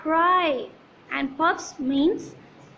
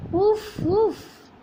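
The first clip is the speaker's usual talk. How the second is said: higher puffing sounds; blowing on food; 6 semitones higher than usual. Shown as a demonstration. Teacher voicing mom blowing on food. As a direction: puff out air up high. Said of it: "She's doing the same".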